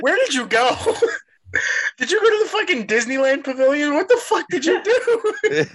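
asking through helpless laughter; laughing hard. Real, not acted. Laughing at a co-host's ridiculous shepherd's pie mix-up. Cracking up at Steve's pie claim.

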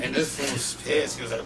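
usual speaking voice

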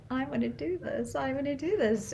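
retold thought in a high, small voice; inner voice. Daughter recalling her thoughts before the bungee jump, high inner voice. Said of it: "I was thinking in my head".

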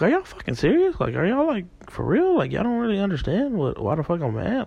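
very high-pitched, incredulous protest; retold exasperated outburst. Dreamer challenging girlfriend's aunt and uncle, high exasperated disbelief. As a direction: act out outrage, voice leaping way up.

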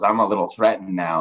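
ordinary talk, nothing marked

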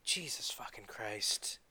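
slow, exasperated whisper; slow whispered curse of disbelief. Real, not acted. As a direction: breathe it out slowly in disbelief. Exasperated rant about online figures.

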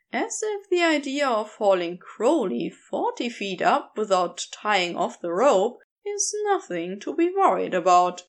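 ordinary delivery, nothing marked

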